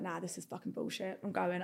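very soft muttered grumble; grumbling quietly to herself. Retold or imitated. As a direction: grumble it under your breath.

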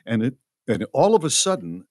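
ordinary talk

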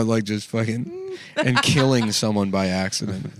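ordinary talk

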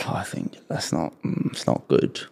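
softly whispered verdict. Real, not acted. Judging her friend for ditching him, a real whisper. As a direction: breathe it out under your breath.

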